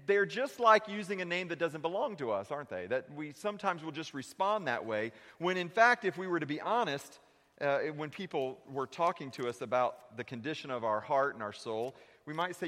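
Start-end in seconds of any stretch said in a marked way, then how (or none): none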